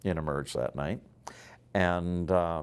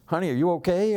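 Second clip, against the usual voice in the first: worried wife; 10 semitones higher, 7 dB louder, faster than usual. Retold or imitated. Wife checking on crying husband.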